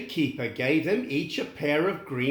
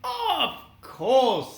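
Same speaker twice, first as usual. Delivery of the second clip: smiling; 9 semitones higher than usual. Acted. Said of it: "said Dorothy with a smile".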